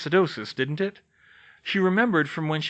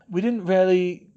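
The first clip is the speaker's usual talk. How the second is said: hesitant man voice; 6 semitones higher, 5 dB louder than usual. A narrator's reading. Bill hesitant answer.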